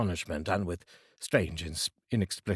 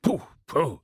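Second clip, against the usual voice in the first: a higher, louder scoffing pooh pooh; dismissive Scrooge; 6 semitones higher, 4 dB louder than usual. Acted. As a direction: brush it off as Scrooge.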